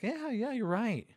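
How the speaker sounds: high, humoring yeah yeah; sarcastic humoring of a deluded man; mock agreement. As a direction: play along sarcastically, pitched high.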